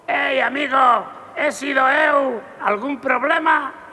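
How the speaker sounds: loud, slow, high challenge; menacing challenge. Acted. Big gunman challenging the little man, loud and slow. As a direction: dare him to say something, slowly.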